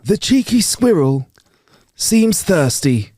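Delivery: slow, high, savoured wildlife narration; fond documentary narrator voice; David Attenborough impression. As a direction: linger on "cheeky squirrel" like a nature presenter. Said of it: "He'll just say that".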